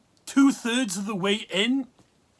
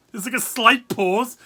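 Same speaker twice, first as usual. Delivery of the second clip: ordinary talk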